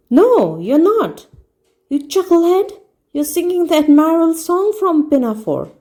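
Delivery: heckling friend. A narrator's reading. Friend mocking Harris from the back, high and scornful. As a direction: jeer at him from the back.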